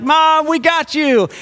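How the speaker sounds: high, slow, triumphant "got you"; gleeful gotcha. Retold or imitated. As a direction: crow it like a kid who pulled off a prank. Kids springing the prank on mom.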